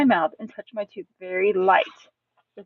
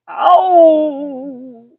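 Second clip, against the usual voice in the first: long loud yelp, tailing away; drawn-out pained wail; cry of pain; 6 semitones higher, 11 dB louder than usual. Acted. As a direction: howl it as if bitten. Grandpa's finger gets bitten.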